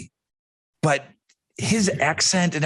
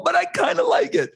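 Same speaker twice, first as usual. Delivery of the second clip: much higher, sing-song repeat of the admission; higher playful repeat. Real, not acted. Cohost repeats the admission, higher and playful. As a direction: say it again, pitched way up and teasing.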